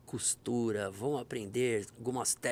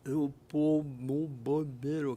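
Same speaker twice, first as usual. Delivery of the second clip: slurred voice; much slower than usual. Retold or imitated. Repeats the stroke victim's answer.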